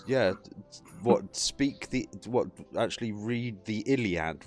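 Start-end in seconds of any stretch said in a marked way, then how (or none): none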